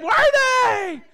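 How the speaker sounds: very high, drawn-out holler; imitated excited outburst. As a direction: burst out high like the irrepressible convert. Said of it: "jumped up again and said".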